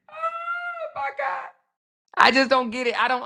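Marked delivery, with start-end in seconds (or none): 0.1-1.5 high, slow, drawn-out disbelief; exasperated. Real, not acted. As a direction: stretch it out, stunned. Exasperated at commenters.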